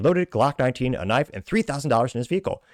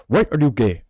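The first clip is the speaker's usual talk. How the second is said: louder, badly faked Ugandan accent; attempted Ugandan accent; 5 dB louder than usual. Host mimics a famous reporter from Uganda, bad Ugandan accent. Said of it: "famously says in his accent, which I will attempt".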